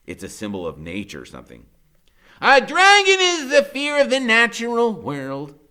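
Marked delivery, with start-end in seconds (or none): 2.4-5.6 high, loud lofty lecturer; lofty Freak. A narrator's reading. Freak explaining dragons, high loud lofty voice. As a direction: pronounce it like a scholar, high and loud. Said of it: "Freak says".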